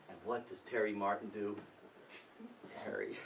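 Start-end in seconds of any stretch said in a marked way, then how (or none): none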